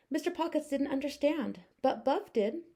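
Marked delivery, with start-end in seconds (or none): none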